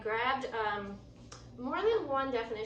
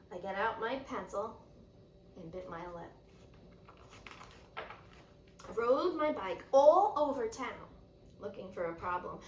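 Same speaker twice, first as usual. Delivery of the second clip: plain reading voice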